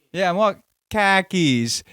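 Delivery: badly faked Jersey accent, drawn out; attempted Jersey accent. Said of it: "What do they sound like again?".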